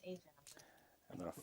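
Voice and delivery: slow hushed whisper. Real, not acted. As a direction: whisper the card reveal slowly. Whispered turn card reveal.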